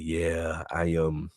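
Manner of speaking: low, soft, hesitant mock answer; imitated sheepish reply. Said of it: "He said".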